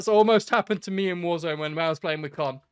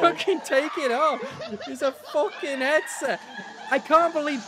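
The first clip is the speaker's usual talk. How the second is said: laughing; 10 semitones higher, 3 dB louder than usual. Real, not acted. Laughing at scared player.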